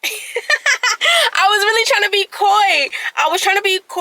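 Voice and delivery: high, loud, amused confession; laughing. Real, not acted. Admitting to viewers she was trying to be coy, laughing. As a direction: burst out laughing and carry it into the words.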